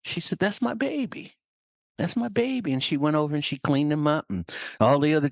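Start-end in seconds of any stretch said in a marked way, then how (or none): 0.4-2.7 high-pitched motherly claim; high, fond mother voice; doting mother hen. Acted. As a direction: coo proudly over an odd baby, high. Hen claims her odd baby.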